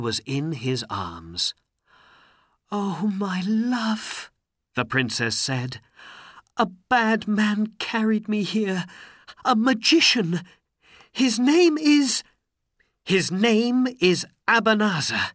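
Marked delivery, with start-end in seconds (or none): 2.7-4.3 high, slow, drawn-out cry of love; emotional princess. A narrator's reading. Princess greets Aladdin in his arms, emotional. Princess reunited with her husband. 6.6-15.3 high, loud, upset princess voice; distressed princess. A narrator's reading. Princess tells her husband of her kidnapper, distressed.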